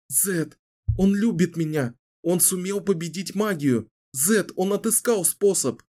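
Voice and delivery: high, bright with joy; joyful. Acted. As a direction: burst out in delight. Kahlan overjoyed telling Zedd.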